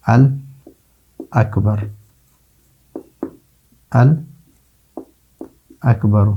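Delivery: الاکبر very slowly, long pause after "al"; lam pronunciation demonstration. Shown as a demonstration. Teacher showing the lam is pronounced, slow broken-up الاکبر. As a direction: split الاکبر into "al... akbar", lam clearly sounded. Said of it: "لام کا تلفظ ہوتا ہے".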